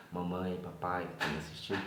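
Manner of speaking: normal talking voice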